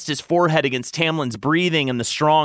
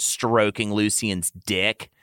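usual speaking voice

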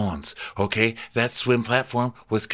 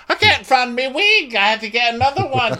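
much higher, louder silly falsetto; squeaky flustered cartoon voice; 6 dB louder than usual. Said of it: "the captain's like".

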